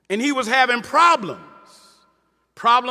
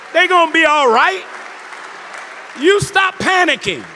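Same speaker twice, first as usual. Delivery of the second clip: preaching at full force; 3 semitones higher than usual. Acted. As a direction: preach it out to the crowd, building. Preaching to congregation.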